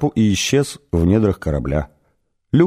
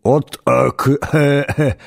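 broken choking syllables, pitched a little up; tongue-tied stammer; 5 semitones higher, slower than usual. A narrator's reading. Arthur speechless before the alien, tongue-tied stammer. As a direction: sputter fragments, unable to form a word. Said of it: "боролись за контроль над его голосовыми связками".

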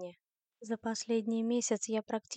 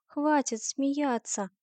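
slow, raised, louder protest; indignant; 5 semitones higher, 5 dB louder, much slower than usual. Acted. Liv objects to friends laughing at her, indignant. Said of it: "возмутилась она".

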